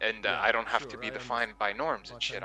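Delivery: ordinary talk, nothing marked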